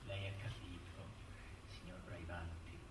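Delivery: barely voiced, very low and soft; imply it almost under the breath; hushed insinuation. Lawyer hints at the unspoken to Braibanti, very hushed.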